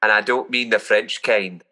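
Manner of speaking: broad Scottish brogue, gruff and teasing; thick Scottish accent on the phone. Acted. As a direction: play a Scottish customer ordering pizza. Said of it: "best Scottish accents I've heard".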